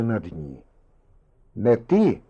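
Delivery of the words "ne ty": mildly raised call. A narrator's reading. Husband leans over his sleeping wife, calling out. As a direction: call the name out to rouse a sleeper.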